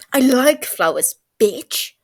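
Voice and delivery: loud, defensive snap; loud snarl defending himself; angry snap. Acted. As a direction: bite back hard, fast. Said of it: "Tommy snaps immediately".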